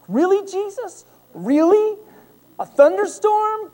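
high, loud, disbelieving whine to Jesus; exasperated complaint. Retold or imitated. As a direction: complain to heaven in mock outrage. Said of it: "And I'm like".

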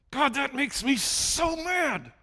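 high, drawn-out strained outburst; venting anger. Acted. Preacher venting to God, hammed-up exasperated anger. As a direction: vent at God, stretching the words in exasperation. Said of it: "when I get so frustrated and I start to vent".

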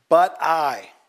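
"But I" pushed out loud and strong; emphasis on but I. Shown as a demonstration. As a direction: lean hard on "But I". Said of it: "emphasis here".

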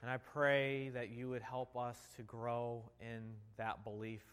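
hushed pastor's prayer; leading prayer. Acted. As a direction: pray aloud for the congregation. Leads the congregation in prayer.

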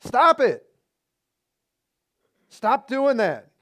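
loud high stop it; sharp rebuke. Real, not acted. Preacher scolding the whiners, loud and sharp. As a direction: cut them off hard. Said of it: "preacher rebukes congregation".

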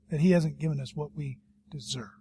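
awed whisper; hushed whisper. Real, not acted. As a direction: drop to a whisper of gratitude. Solemn close of point.

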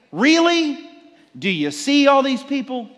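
high, loud incredulous mockery; sarcastic outburst. Disciples scoffing at Jesus, loud and incredulous. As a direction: throw the disbelief back at him. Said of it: "turning around to the Lord of all creation and saying".

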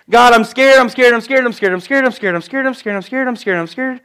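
hammed-up scared chant; mock panic. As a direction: babble it over and over in pretend fright. Acts frightened believers at river.

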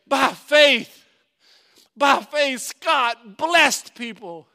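loud, drawn-out proclamation; ringing sermon climax; full-force preaching. Acted. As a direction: proclaim it slowly and forcefully from the pulpit.

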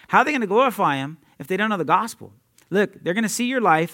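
normal talking voice

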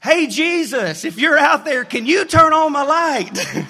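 put-on high, slow, loud child's yell; a boy shouting up to Jesus, sure of himself; confident shout. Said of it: "with confidence ... screams back".

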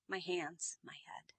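dropping to a near whisper; hushed offering. Retold or imitated. Peter humbly offering himself to Jesus, hushed. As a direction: offer it softly, almost without voice.

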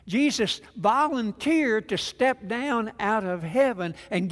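preaching at full force. Acted. Pastor driving home the sermon to his congregation, fervent loud preaching.

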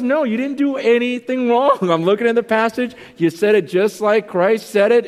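high, loud retold insistence; emphatic reassurance. Retelling colleague's worked-up reassurance.

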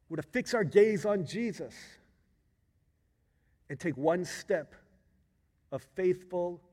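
high, forceful pulpit exhortation; emphatic preaching. Acted. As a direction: proclaim the lesson phrase by phrase. Preaching the application.

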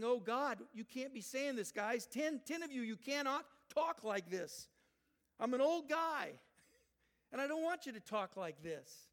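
high, loud exasperated pleading; exasperated protest. Acted. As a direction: protest like a fed-up old leader. Voicing Moses' exasperated thoughts.

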